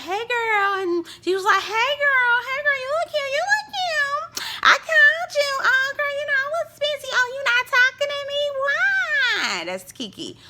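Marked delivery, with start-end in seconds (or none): 0.0-1.6 high, sing-song fake-friendly girl voice. 1.6-2.4 high, sing-song fake-friendly girl voice. 2.4-2.8 high, sing-song fake-friendly girl voice. 2.8-3.3 high, sing-song fake-friendly girl voice. 3.3-4.4 high, drawn-out fake-sweet compliment; slow sing-song. 4.6-9.6 high, sing-song fake-friendly girl voice.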